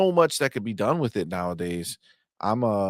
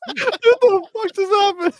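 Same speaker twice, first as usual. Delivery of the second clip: much higher, slow, louder through laughter; laughing disbelief; 6 dB louder than usual. Real, not acted. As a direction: gasp it out still laughing. Stunned by ad interruption.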